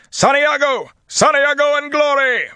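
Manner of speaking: loud, high war shout; yelled charge into battle; battle cry. A narrator's reading.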